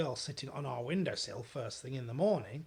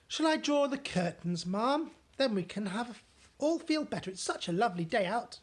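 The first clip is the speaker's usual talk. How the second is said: higher, bright offer; cheerful maid; 7 semitones higher, 5 dB louder than usual. Acted. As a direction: lift the mood.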